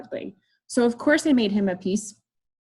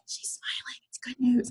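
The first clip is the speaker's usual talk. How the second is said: excited secret whisper; whispered aside; 4 semitones higher, 7 dB quieter than usual. Retold or imitated. Husband to wife about the half-smiling tech, whispered. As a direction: whisper it urgently to your partner.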